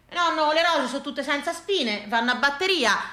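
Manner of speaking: ordinary delivery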